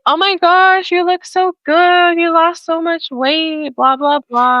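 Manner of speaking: imitated high-pitched gushing, easing down by the end; gushing compliments. People complimenting her on losing weight, imitated high-pitched gushing. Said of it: "all these compliments from people like".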